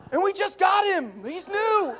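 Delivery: high, loud, laughing cry; panicked through laughter; a kid sure he killed dad. Retold or imitated. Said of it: "continuing the quote".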